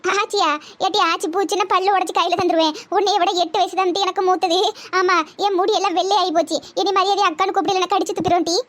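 a cranky village grandma who hates being called aachi; a hint of a sharp old woman snapping at the girls; bossy old granny. Old granny barges in, scolds.

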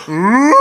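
loud, high "ooh" of disbelief; hammed-up howl of shock; mock howl. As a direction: imitate a stunned prank victim howling. Imitates stunned prank victims.

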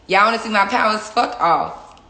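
loud playful blast; mock power blast. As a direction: hurl it out like unleashing powers. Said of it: "Y'all see my, my powers".

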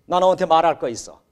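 usual speaking voice

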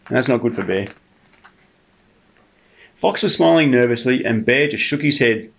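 ordinary delivery

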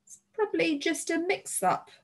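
put-on high, light girl's voice; smooth it over in a high young voice; lightly voiced girl covering up; hasty reassurance. A narrator's reading. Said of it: "Tulip said quickly".

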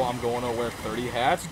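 ordinary talk

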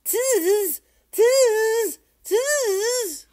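very high, loud monkey squeal; high-pitched storybook monkey cry; chattering monkeys. Acted. Monkeys mimicking the peddler in story.

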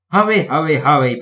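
loud, urgent, lightly acted pant; panted urging. A narrator's reading. Thomas racing Bertie, urging with a panted effort. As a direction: push each word out in a hurry. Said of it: "panted Thomas".

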